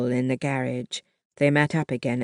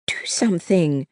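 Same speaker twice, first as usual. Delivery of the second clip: hissed; 3 semitones higher than usual. A narrator's reading. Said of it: "she hissed".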